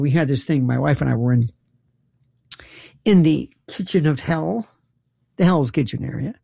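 ordinary talk, nothing marked

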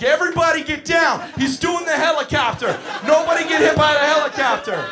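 high, loud alarmed warning; panicked shout. Retold or imitated. Counselor warning kids to duck the spinning kid, panicked shout. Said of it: "And I lose my shit".

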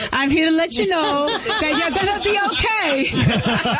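high, drawn-out cheer through laughter; laughing reassurance. Real, not acted. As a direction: reassure them brightly while cracking up. Cheerful joke after grim life story.

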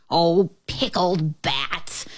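slow, drawn-out witchy groan; groaning witch. A narrator's reading. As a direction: groan it out slowly as the exasperated witch. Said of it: "groaned the witch".

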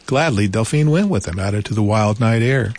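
usual speaking voice

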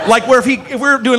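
usual speaking voice

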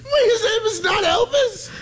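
high, shrieking laugh through words; laughing in disbelief. Real, not acted. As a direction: cackle out your shock. Host learns the middle name.

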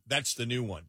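usual speaking voice

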